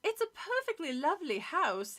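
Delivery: lightly snooty neighbor lady; a hint of a prim snob; snobby voice. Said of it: "do the snobby voice for both".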